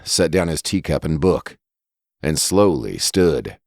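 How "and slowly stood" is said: plain reading voice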